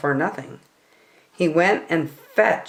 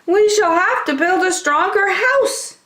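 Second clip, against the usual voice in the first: much higher, determined little wolf voices; little wolves. Acted. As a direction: resolve in tiny voices. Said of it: "they said".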